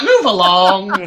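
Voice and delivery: slow deadpan brush-off. Retold or imitated. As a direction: drag it out, unbothered. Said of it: "Mike's just like".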